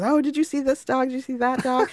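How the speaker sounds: high-pitched, delighted questions; excited dog spotting. Retold or imitated. Dog lovers pointing out dogs, high excited chatter.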